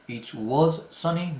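it was sunny, slow, rising; slow model sentence. Shown as a demonstration. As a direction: lay out the positive form slowly.

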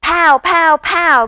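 high, very loud pows; drum noises. A narrator's reading. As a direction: imitate banging drums. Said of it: "go the drums".

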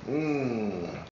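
tight closed-mouth hum; small mouth hum. Shown as a demonstration. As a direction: hum with pursed lips. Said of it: "let's make the mouth small".

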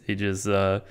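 ordinary talk, nothing marked

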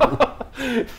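a high, sudden laugh at the absurd memory; burst of laughter. Real, not acted. Telling a friend about stealing back his dog, laughing.

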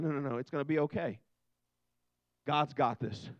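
calm reassurance. Pretend. Reassuring the world calmly.

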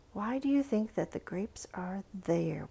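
hushed whispered question. Real, not acted. Teacher whispering a question to children about grapes. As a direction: whisper it like a secret.

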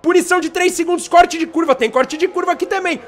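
excited live race call; motorsport commentator. Real, not acted. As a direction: call the overtakes rapid-fire.

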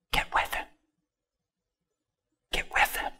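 voiceless whisper; very soft, confiding whisper; hushed answer. Acted. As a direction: whisper the advice, pausing between repeats. Hushed answer to the shouted question.

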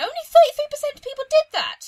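high, loud incredulous outburst; shocked disbelief. Real, not acted. Gamer shocked by choice statistics, loud incredulous cry. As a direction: exclaim in disbelief, voice shooting way up.

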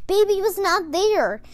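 plain reading voice

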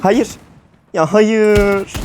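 high, loud wail of defeat; despairing wail. Real, not acted. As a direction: cry out as the game is lost. Dies again, despairing cry.

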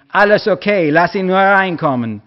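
wife calling from the house to her husband; woman calling out. A narrator's reading. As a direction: shout back to calm her husband. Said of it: "die schrie".

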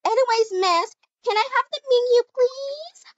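sugary request. Acted. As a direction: ask sweetly, fluttering upward. Customer asks sweetly for menu.

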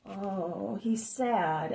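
usual speaking voice

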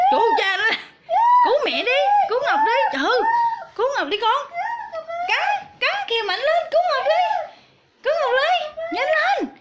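high, urgent mock cries for help; giả vờ kêu cứu. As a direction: call the dog to the rescue, pitched high. Giả vờ kêu cứu giục chó. (giả vờ la cầu cứu, giọng cao)

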